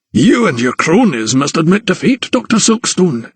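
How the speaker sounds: high-voiced, nervous gloater; gloating anatomist. A narrator's reading. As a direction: claim victory over a rival doctor.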